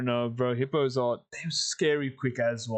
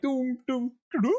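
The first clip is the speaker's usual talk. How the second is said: high repeated doom beats; imitated metronome beat; 11 semitones higher, slower than usual. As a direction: mimic a ticking metronome. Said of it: "Sounds like playing a metronome".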